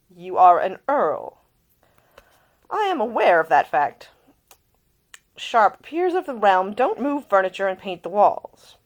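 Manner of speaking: hint of a stern older lord; mock-stern uncle. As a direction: uncle chiding his nephew. Isleston scolds Nick.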